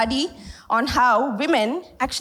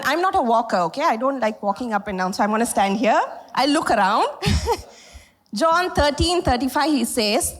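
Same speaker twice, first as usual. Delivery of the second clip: ordinary talk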